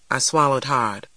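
usual speaking voice

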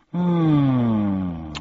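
long falling "hmm"; pondering hum. A narrator's reading. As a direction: hum loudly while thinking it over. Puzzled man ponders the question.

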